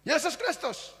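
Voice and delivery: high, loud proclamation of praise; exalted preaching. Acted. Preacher exalts Jesus before the congregation, high and loud. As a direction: lift Christ up in praise.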